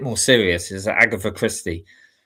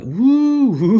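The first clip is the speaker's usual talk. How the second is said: high, loud mimicked crowd whoops; crowd cheering; 14 semitones higher, 6 dB louder than usual. Imitating a crowd as performers come onstage, whooping. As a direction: whoop like an excited audience. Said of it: "all the crowd were going like".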